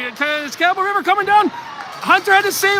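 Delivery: high, urgent call of the rush; excited play-by-play. Real, not acted. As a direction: call the breakaway with rising excitement.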